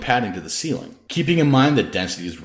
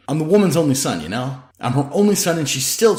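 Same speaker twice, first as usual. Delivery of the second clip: raised, louder voice, cracking with hurt; hurt outburst; 7 semitones higher, 4 dB louder than usual. Acted. Antics to Hendrix on the porch about his mother, raised hurt voice. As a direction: let the pain about his mother spill over, higher and louder.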